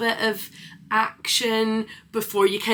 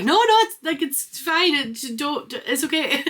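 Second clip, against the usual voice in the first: much higher, squeaky flustered retreat; flustered backtrack; 7 semitones higher than usual. Retold or imitated. Scottish person flustered, hastily backing off in a high squeak. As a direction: play someone hastily backing down. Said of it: "And people are like".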